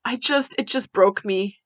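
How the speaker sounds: ordinary delivery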